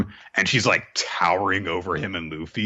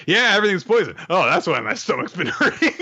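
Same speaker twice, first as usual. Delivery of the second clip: higher, louder cheerful goof; carefree Luffy; 11 semitones higher, 4 dB louder, faster than usual. Retold or imitated. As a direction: laugh off the poison cheerfully. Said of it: "They're like".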